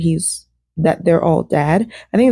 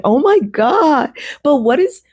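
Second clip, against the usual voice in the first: shocked disbelief; 8 semitones higher than usual. Real, not acted. Reacting to the boyfriend's lie.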